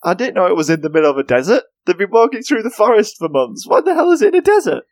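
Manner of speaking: exasperated, can't believe it; incredulous. Real, not acted. Baffled rant about desert.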